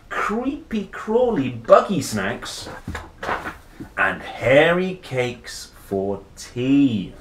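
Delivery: lively picture-book read, slowing on the treats; reading to children. A narrator's reading. Reader listing Rory's monster treats, playful and slow. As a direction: savour each yucky snack for the kids.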